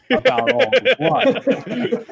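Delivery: words through a laugh. Real, not acted. Laughs while joking about cab.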